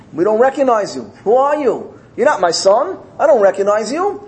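high-pitched, disbelieving challenge; indignant rejection. Acted. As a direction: turn away a stranger as an indignant king. King rejects his son, acted.

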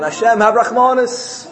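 high-pitched acted appeal; pleading call. Sinner comes to Hashem at Rosh Hashanah, high pleading call.

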